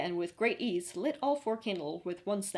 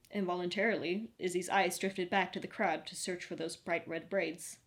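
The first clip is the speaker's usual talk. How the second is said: ordinary delivery, nothing marked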